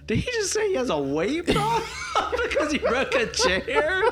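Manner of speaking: high, giggling quote; laughing disbelief. Retold or imitated. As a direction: laugh through the question. Imitating audience reaction, laughing.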